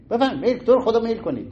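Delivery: insistent host offering. Retold or imitated. Host pushing fruit on guests.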